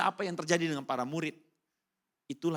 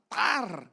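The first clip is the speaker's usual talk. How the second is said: sharp, higher "tar" for the rattan strike; imitated cane whack; 9 semitones higher, 6 dB louder than usual. Mother caning the boy, sharp whack sound.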